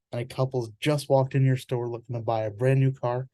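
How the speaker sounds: usual speaking voice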